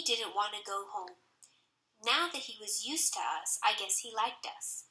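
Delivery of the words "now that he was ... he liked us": ordinary delivery, nothing marked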